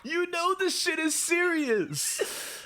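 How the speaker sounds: exasperated scolding. Retold or imitated. Cohost scolding Diana for acting brand new, high incredulous scolding. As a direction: call her out, half laughing in disbelief.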